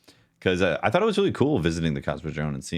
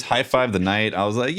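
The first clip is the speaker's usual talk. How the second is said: normal talking voice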